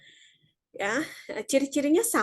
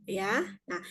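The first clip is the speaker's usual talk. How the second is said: normal talking voice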